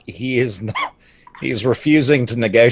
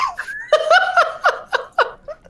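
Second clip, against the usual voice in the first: high burst of laughter. Real, not acted. Player laughs loudly.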